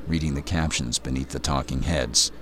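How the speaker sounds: ordinary delivery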